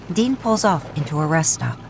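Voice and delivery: plain reading voice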